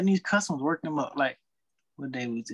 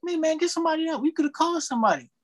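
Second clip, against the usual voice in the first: demanding customer; 11 semitones higher than usual. Retold or imitated. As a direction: push him hard like a rude customer. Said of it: "he was like".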